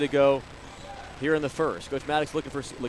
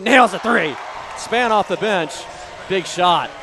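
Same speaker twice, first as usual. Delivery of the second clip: loud, raised commentator voice; excited three-point call; 4 semitones higher, 7 dB louder, slower than usual. Real, not acted. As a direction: celebrate the shot in punchy bursts. Calling a bench player's three.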